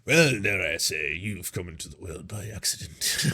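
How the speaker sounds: low, slow, very soft haughty insult; a quiet, pompous put-down; mock stuffy MP. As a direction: sneer it down low like an old MP.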